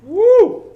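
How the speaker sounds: high, loud "woo"; excited whoop. Real, not acted. Player cheers a big damage roll, excited whoop. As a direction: let out one long high whoop.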